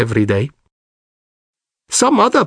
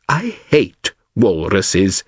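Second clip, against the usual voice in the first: ordinary delivery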